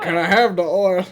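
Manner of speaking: put-on high, loud old prospector voice. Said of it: "say it like an old prospector".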